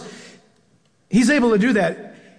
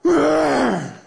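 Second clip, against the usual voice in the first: playful growl of a lion; a hammed-up lion roar; mock lion roar; 5 semitones higher than usual. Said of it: "And he'll roar".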